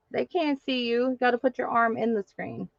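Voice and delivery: normal talking voice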